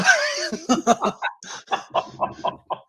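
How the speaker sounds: shrill burst of giggling; high squealing laugh. Real, not acted. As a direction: crack up into a squeal. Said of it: "You messing me up".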